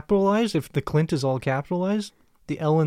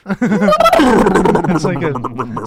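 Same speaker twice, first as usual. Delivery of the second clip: vroom vroom engine; 3 semitones higher, 9 dB louder, much slower than usual. Pretend. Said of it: "doing an impression of a motorbike".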